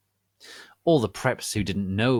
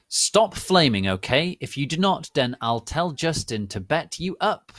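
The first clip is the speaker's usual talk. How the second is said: higher, louder heated warning; angry threat; 6 semitones higher, 5 dB louder than usual. Acted. Author warning flamers she'll get them beaten up.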